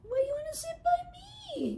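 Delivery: cat's voice, puzzled; voicing the cat. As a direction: speak for the cat in a tiny voice. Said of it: "she's just".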